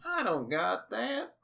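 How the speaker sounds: put-on high, slow folksy old man. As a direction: feign humble old man. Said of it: "and Bob goes".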